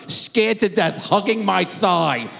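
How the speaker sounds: normal talking voice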